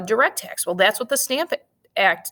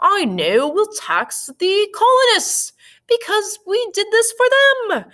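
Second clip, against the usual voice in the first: put-on terrible British accent; 13 semitones higher, 4 dB louder than usual. England deciding to tax the colonists, terrible British accent. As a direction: drag it out in a badly faked British voice. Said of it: "That's my terrible British accent".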